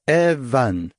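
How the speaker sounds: Evan spoken slowly, every sound shaped; slow and clear. A narrator's reading. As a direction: slowly model Evan for a learner. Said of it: "I repeated slowly so that he could watch".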